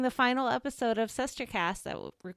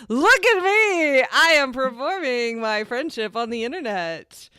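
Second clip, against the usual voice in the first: mock show-off; 4 semitones higher, 7 dB louder than usual. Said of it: "every so often I'm like".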